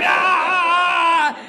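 long, high drawn-out "aah"; hammed-up yell of a bowler willing the ball over; straining yell. Speaker mimicking a bad bowler to the room, straining yell.

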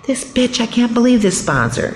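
high, indignant grumble; resentful inner voice. Retold or imitated. Sponsee grumbling inwardly about her sponsor, resentful. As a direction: voice the petty thought in your head. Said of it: "that little thing in your m-, mind is like".

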